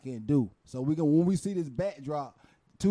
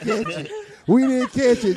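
loud laughing repeat; laughing loudly; 8 semitones higher, 11 dB louder than usual. Real, not acted. Laughing at missing the shot.

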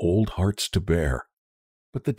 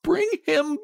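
slow, very high cry; desperate cry; slower than usual. A narrator's reading. Old woman drags husband to the door, desperate cry. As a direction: demand it, stretching each word. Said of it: "cried the old woman".

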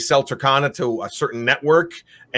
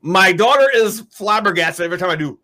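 normal talking voice

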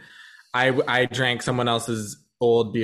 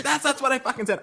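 pitched-up retold snap; mock drunk argument; 10 semitones higher, faster than usual. Arguing with her friend in an Uber, pitched-up mock anger. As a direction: replay the drunken fight, half-acted.

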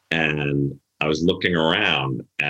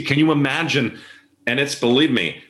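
ordinary talk, nothing marked

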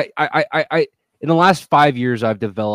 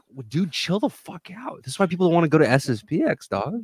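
higher, fast exasperated scolding; exasperated brush-off; 6 semitones higher than usual. Retold or imitated. Telling the doxxer to calm down, high and fast. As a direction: chill the guy out, fast and exasperated.

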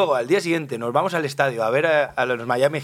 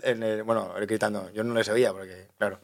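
ordinary delivery

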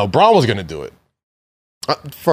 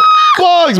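surprised; 13 semitones higher, 4 dB louder than usual. Retold or imitated. Surprised to see Bugs appear.

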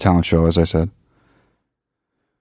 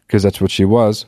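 ordinary delivery

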